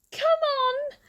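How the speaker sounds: high, whiny "come on!"; a sing-song plea to hurry; impatient. Real, not acted. Waiting for someone who is late, whining impatiently.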